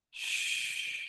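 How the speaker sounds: soft drawn-out shushing sound; long shush. Shown as a demonstration. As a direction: shush like soothing a baby. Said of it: "making a shush sound".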